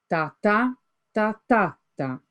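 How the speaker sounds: "ta ta, ta ta ta" tapped out as a steady beat; rhythm beats. Shown as a demonstration. Teacher beating out the line's rhythm for students, chanted. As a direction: tap out the metre on "ta". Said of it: "Now look at the stressed syllables".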